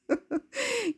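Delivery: very high soft laugh; quiet high giggle; high breathy laugh. Real, not acted. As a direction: laugh at your own panic. Laughing after retelling her hurry.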